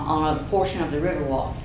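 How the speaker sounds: ordinary delivery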